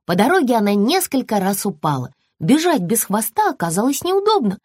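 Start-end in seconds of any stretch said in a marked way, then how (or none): none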